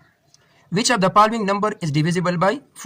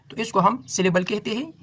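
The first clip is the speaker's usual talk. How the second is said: ordinary talk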